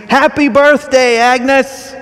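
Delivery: loud, high birthday shout. Retold or imitated. Crowd surprising Agnes at the diner, shouting. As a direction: yell it out like a whole room at once. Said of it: "They all shout and scream".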